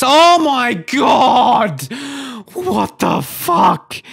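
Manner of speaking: loud, high, dragged-out outburst; furious shout. Real, not acted. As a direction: explode at the blunder. Conceding a goal chance.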